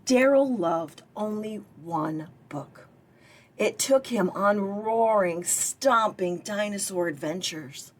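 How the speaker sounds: usual speaking voice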